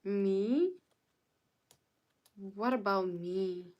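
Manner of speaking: ordinary delivery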